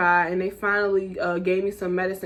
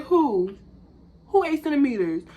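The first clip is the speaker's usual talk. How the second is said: shocked disbelief; 7 semitones higher than usual. Retold or imitated. Disbelief at midwife's news.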